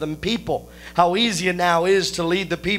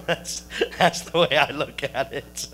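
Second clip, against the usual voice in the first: laughing; 4 semitones lower, 3 dB quieter than usual. Real, not acted. Laughing at his own pasta joke.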